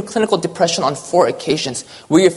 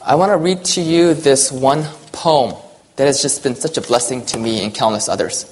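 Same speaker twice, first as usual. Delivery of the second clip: ordinary delivery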